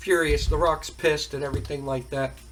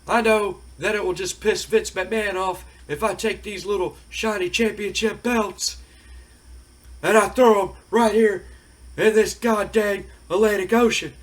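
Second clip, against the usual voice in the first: Stone Cold taunting; 5 semitones higher, 3 dB louder than usual. Retold or imitated. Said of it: "And he goes".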